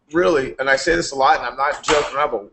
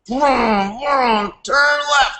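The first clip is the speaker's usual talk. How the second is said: high, mocking turn left chant; mock the endless left turns of a car race; mock race commentary; 10 semitones higher, much slower than usual. Mocking nascar to a mentor. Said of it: "Cause I was like".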